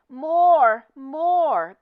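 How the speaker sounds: loud, high chant of a cheering crowd; crowd shouting. Acted. Shoppers shouting for an encore. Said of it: "the shoppers called".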